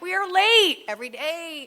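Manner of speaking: high, loud exasperated cry; high and loud in mock exasperation; frazzled mom. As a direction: blurt it like a harried mother at her limit. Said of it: "And I'm like".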